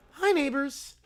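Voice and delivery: ordinary talk, nothing marked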